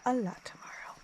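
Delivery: soft whisper at bedtime. Real, not acted. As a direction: whisper so others can sleep. Night in tent, whispering.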